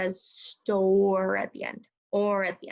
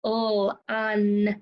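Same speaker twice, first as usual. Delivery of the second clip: tapped out sounds; 4 dB louder than usual. Shown as a demonstration. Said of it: "Let's tap this one out".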